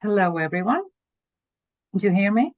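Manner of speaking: ordinary delivery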